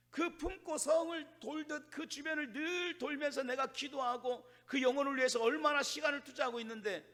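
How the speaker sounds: high-pitched, worked-up pleading; exasperated complaint. Acted. Preacher voices fed-up believer complaining to God, exasperated. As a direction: protest to the Lord in mock exasperation.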